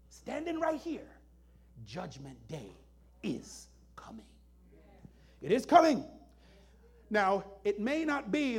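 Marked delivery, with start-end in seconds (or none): 1.8-4.3 whispered, much softer and lower; hushed whisper. Acted. Preacher drops to a whisper. 5.4-6.0 louder firm declaration. Acted. Repeats aloud after whisper.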